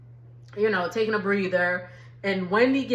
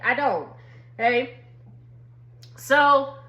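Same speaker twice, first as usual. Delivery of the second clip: usual speaking voice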